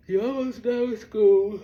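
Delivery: drawn-out urging, pushing someone on; loud encouragement. Retold or imitated. Urging someone almost done with school, loud encouragement.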